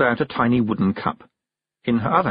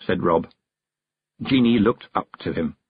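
ordinary delivery

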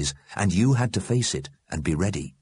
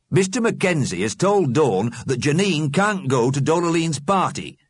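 high, animated character voice; absorbed soap viewer; 9 semitones higher, 4 dB louder than usual. A narrator's reading. William Stickers relays the soap plot, engrossed. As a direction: report the plot with great interest.

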